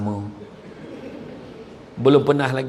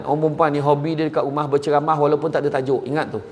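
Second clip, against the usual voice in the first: normal talking voice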